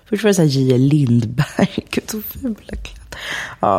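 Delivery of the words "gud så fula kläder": laughing aside, voice dropping away soft and airy. Real, not acted. Guest scoffing at once-trendy jeans, laughing. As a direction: laugh softly through the words.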